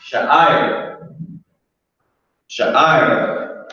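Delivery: "Sha'ir" said as sha-ee-ir with a rising end; careful pronunciation. Shown as a demonstration. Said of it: "That's how you say it".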